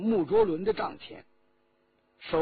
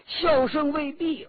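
plain reading voice

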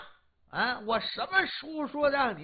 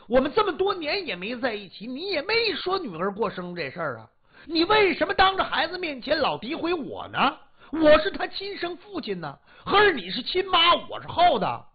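indignant outburst; 7 semitones higher than usual. Acted. Husband shouting back at his wife, rising fury. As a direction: fire back until you snap.

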